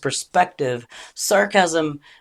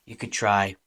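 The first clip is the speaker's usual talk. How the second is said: ordinary talk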